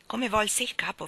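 usual speaking voice

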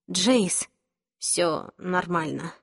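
soft, slow and gentle; calm him down softly; reassuring. A narrator's reading. Clary comforts Jace after the fight.

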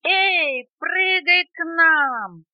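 high, drawn-out holler; long, high, rousing yell; loud shout from above. A narrator's reading. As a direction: call out loud to someone far below. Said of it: "услышал наверху громкий возглас".

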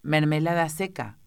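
plain reading voice

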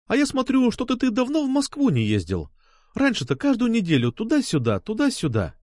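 put-on high, needling girl's voice; jealous schoolgirl. A narrator's reading. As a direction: play a suspicious girl pressing her point. Jealous girl needles him. (ревниво, с подковыркой)

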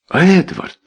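high-pitched gasped name; breathless shock. A narrator's reading. Wife hearing the size of the debt, shocked. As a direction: cry his name in shock. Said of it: "У леди Белвиль перехватило дыхание".

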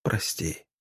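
very soft, low last word; barely voiced, fading murmur; almost soundless. A narrator's reading. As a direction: breathe out a dying apology. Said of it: "почти беззвучно".

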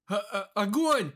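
stammering order to fire; shout. Acted. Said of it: "прокричал командир".

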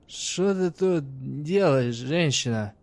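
put-on slow, slurred drunk voice. A narrator's reading. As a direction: drag the words like a drunk. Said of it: "сражаясь с заплетающимся языком".

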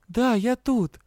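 high, loud call through the door. A narrator's reading. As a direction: call out so he hears. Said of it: "повысив голос". (громко, высоко, через дверь)

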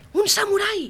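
high, loud cry of alarm; false alarm shout. Acted. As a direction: shout a sudden warning to scatter the soldiers. Joke prisoner raises false alarm. (grito agudo de alarma)

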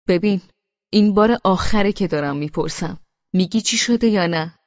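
angry husband's final demand to his sulking wife; angry threat. Acted. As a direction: lay down a last warning with anger.

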